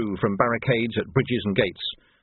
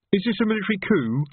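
uncertain; 9 semitones higher than usual. A narrator's reading. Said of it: "said Reg uncertainly".

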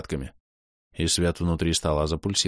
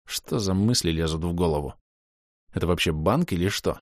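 ordinary delivery, nothing marked

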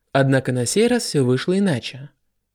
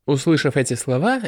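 usual speaking voice

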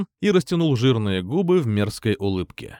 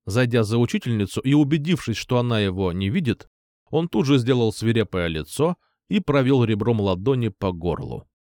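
plain reading voice

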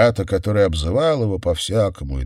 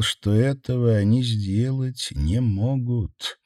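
ordinary delivery, nothing marked

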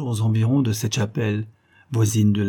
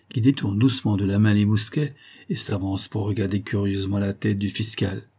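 ordinary delivery, nothing marked

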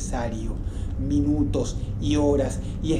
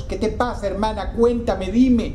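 much higher, building loud on dime; frantic plea; 7 semitones higher, 3 dB louder than usual. A narrator's reading. Boy pleading in the dark, frantic rising cry.

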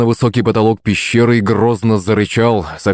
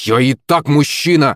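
indignant, pitched-up protest; mild growled retort; 6 semitones higher than usual. A narrator's reading. Misha answering the girl's taunt, mildly growling indignation. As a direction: bristle back at her, pitched up. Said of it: "зарычал в ответ Миша".

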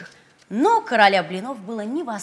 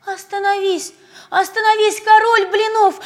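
high, loud chasing cry; shouting; 12 semitones higher, 13 dB louder than usual. Acted. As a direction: yell after him. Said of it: "кричали в один голос".

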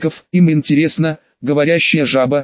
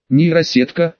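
usual speaking voice

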